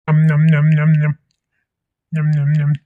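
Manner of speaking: chomp and smack the food noisily; greedy mock munching noises; noisy chewing sounds. Hungry man eating food.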